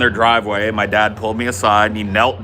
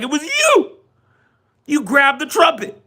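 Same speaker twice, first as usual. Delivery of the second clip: put-on very high-pitched kid pointing blame; childish accusation. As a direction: accuse like a child sticking to a lie. Retold child accusing another.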